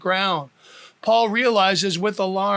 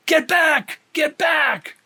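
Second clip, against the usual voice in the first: high, louder alarmed shout; officer shouting a warning; 8 semitones higher, 3 dB louder, slower than usual. Acted. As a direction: yell to stop him. Officer shouts as man may fly.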